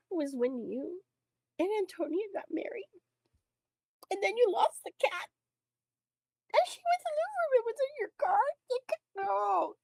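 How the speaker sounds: re-enacted tearful fan, voice very high; overwrought, high-pitched weepy confession; crying. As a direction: squeak it out through tears. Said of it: "I was crying".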